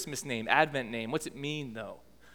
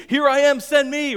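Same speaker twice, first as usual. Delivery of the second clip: put-on much higher and louder, squealed like a toddler; eager kids; 10 semitones higher, 10 dB louder than usual. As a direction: shriek it as an excited little kid volunteering. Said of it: "they're like".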